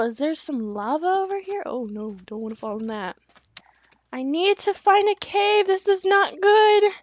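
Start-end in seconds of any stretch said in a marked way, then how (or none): 4.1-6.9 loud, high worried panic; rising alarm, night is coming; panicking. Real, not acted. Night falls in the desert.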